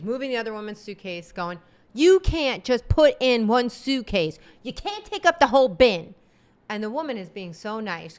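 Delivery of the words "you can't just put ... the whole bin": imitating a rude passenger yelling; mock angry yell. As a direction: bark it at a stranger. Mom yelling at other passenger.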